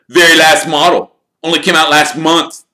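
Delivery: put-on offhand, boastful teenage voice; lightly voiced careless boast; careless Flint. A narrator's reading. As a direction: toss it off like it's nothing. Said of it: "said Flint carelessly".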